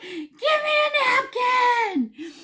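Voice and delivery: very high, loud, stretched-out yell; drawn-out squeal of mock panic; panicked shriek. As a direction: screech a frantic demand. Retold shriek as bug wiggles.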